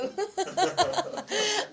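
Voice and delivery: high burst of laughter; real laugh at an awkward question; amused laugh. Real, not acted. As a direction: break into high, giggly laughter. Teasing reply to a question.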